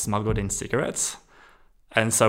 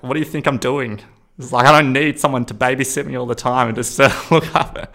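louder, higher exasperated rant; retold anger; 4 semitones higher, 8 dB louder than usual. To the nurse who walked in, a louder exasperated rant. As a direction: vent at the nurse who keeps checking. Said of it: "I got angry for him".

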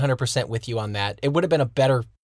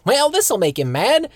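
a higher, slow mock old-man voice; sly old man plotting mischief, higher and slow; Cotton voice; 7 semitones higher than usual. Cotton scheming to anger Hank, higher slow old-man voice. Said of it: "Cotton goes".

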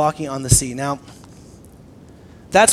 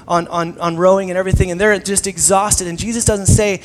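ordinary talk, nothing marked

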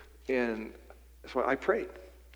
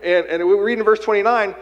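higher and louder, pressing the call to bold prayer; preaching at full force; 5 semitones higher, 7 dB louder than usual. Acted. Pastor urging the congregation to pray boldly, preaching at full force. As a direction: lift it into a ringing sermon climax.

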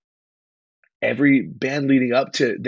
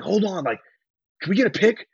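high eager asking for a photo; eager request; 8 semitones higher than usual. Retold or imitated. Rock star asking for a photo, high eager request. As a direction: ask it pitched up, keen. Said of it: "he was like".

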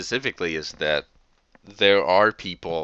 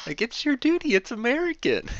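much higher, mocking falsetto; mocking squeaky voice. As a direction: squeak it out to ridicule them. Mocking Republicans' patriotic lecture.

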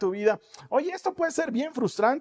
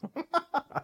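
laughing; 6 semitones higher, 5 dB quieter than usual. Real, not acted. Se ríe tras la cita.

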